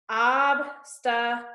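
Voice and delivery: obstacle split into three slow, separate syllables; deliberate syllable count of obstacle. Shown as a demonstration. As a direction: say obstacle slowly with a gap after each syllable. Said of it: "count how many syllables".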